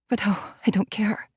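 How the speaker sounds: soft breathy whisper of surrender. Acted. Aching for Enrique, a surrendering whisper. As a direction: let it out in a whisper. Aroused, desperate inner monologue.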